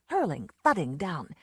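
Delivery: usual speaking voice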